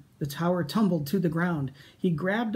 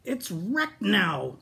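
very slow, higher, let down; dismayed; 5 semitones higher, much slower than usual. Acted. Pteranodon sadly complains about the wrecked tower. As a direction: drag it out in dismay.

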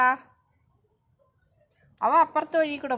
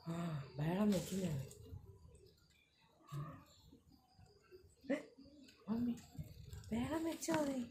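soft and hushed; 11 dB quieter than usual. Real, not acted. Mother talking softly to child.

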